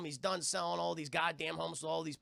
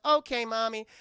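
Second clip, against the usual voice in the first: put-on higher, louder childlike reply; little girl voice; 4 semitones higher, 8 dB louder than usual. Little Carol Anne answering her mom, childlike chirp.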